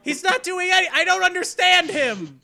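loud, high-pitched exasperation; exasperated outburst. Real, not acted. Baffled by an actor's performance, exasperated outburst.

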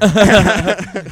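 hearty laugh; loud laugh. Real, not acted. After his own dark joke, hearty loud laugh. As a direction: laugh hard at yourself.

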